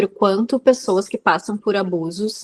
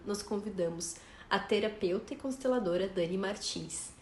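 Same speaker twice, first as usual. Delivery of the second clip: softer, measured introduction read; scripted podcast intro read; 2 semitones lower, 14 dB quieter than usual. Acted. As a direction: read the episode intro, softly and evenly. Reads the episode introduction. (leitura de introdução de podcast, em volume mais baixo)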